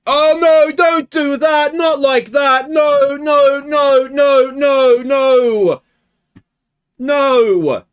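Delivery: loud, high repeated no; anguished shout. Real, not acted. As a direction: yell in horror at the screen. Watching his team concede.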